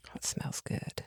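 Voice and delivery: breathy whisper of delight at the smell. Acted. Kobold whispering at the campfire smell. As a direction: whisper it, savoring the food smell.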